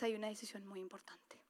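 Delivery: hushed, unvoiced aside; secretive whispered point. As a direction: lean in and whisper it. Preacher drops to a whisper.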